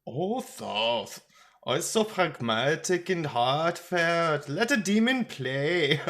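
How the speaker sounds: put-on high, jovial retort with a German accent; chuckling German accent. A narrator's reading. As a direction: tease a friend in a tipsy German lilt. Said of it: "He chuckled".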